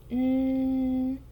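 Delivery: N as a long, level nasal hum; letter sound. Shown as a demonstration. Teacher demonstrates the new letter, letter sound. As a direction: hold N as a continuous nnn, not the letter name. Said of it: "It makes the sound".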